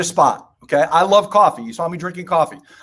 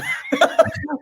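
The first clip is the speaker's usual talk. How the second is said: laugh. Real, not acted. Laughs at own pun.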